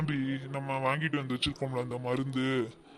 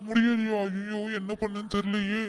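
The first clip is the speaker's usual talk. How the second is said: higher, hammed-up fake concern; mock worry; 6 semitones higher than usual. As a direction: pretend to fret, not meaning it. Feigned worry. (போலியான கவலை)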